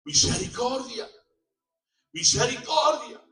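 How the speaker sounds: pleading shout, high and loud; desperate cry. Acted. Condemned man begging the crowd before the axe, shouting. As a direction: beg for your life at the top of your voice. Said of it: "empezó a gritar".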